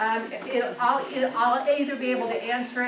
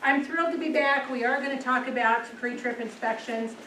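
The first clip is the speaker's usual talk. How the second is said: normal talking voice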